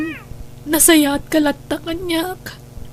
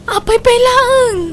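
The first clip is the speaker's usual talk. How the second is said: shocked plea; 9 semitones higher, 5 dB louder, slower than usual. Acted. Wife pleads with husband Roldan.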